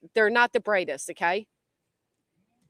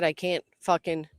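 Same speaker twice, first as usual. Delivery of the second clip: ordinary delivery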